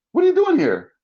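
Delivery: high, loud mom demanding an answer; retold high-pitched astonishment; startled mother. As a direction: imitate a shocked mother. Mother startled when he arrives.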